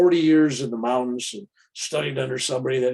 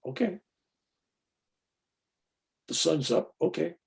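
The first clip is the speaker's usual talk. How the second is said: unimpressed, softer and matter-of-fact; calm okay; 3 semitones higher, 4 dB quieter than usual. Shown as a demonstration. Him after a great experience, calm and unexcited. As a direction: shrug it off: "The sun's up".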